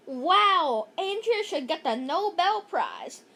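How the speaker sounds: plain reading voice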